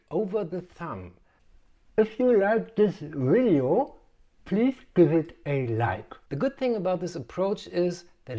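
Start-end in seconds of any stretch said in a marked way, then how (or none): 2.0-6.1 cartoon voice. Inserted like-button plea.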